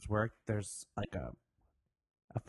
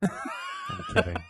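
much higher, giggling through it; laughing; 4 dB louder, much slower than usual. Real, not acted. Admits the Ricky story was a joke, laughing.

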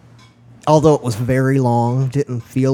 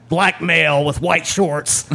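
raised, slow, announced radio call; police radio dispatcher; 4 semitones higher, slower than usual. Retold or imitated. Dispatcher describing a suspect over police radio, announcing voice. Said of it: "I heard on the radio".

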